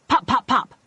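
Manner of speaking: sharp popping gunshots; gunshot sounds. A narrator's reading. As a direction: pop out the shots. Gunshots imitated.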